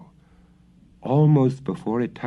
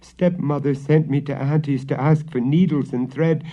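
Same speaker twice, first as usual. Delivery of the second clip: put-on high, louder frightened girl; 6 semitones higher, 4 dB louder than usual. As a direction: pour it out to father. Girl tells father, upset.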